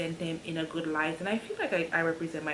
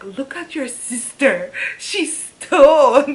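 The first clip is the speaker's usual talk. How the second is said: dad calling out; 7 semitones higher, 12 dB louder, slower than usual. Retold or imitated. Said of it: "he's like".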